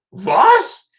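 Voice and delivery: very high, loud disbelief; incredulous one-word yelp; startled. Retold or imitated. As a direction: imitate a baffled German officer. Officer's startled reaction, retold.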